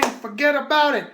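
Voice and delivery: punchy New York "Forget about it", falling on "about"; New York catchphrase. Shown as a demonstration. Host sign-off in New York wiseguy style. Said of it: "the New York thing".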